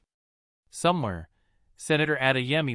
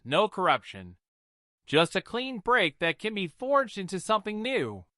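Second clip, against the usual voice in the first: higher voice, slow and measured; mother telling old wisdom; 7 semitones higher than usual. A narrator's reading. Mother telling her daughter the grandfather's saying, solemn. Amara recalls her father.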